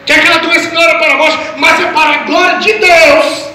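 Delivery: high proclamation, stretched at the close; fervent preaching. Real, not acted. Preacher repeating the promise to the congregation, fervent preaching.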